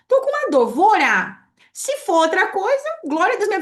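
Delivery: high, loud and firm; loud, confident faith over the pain; bold declaration. Retold or imitated. Her bold response to pain now.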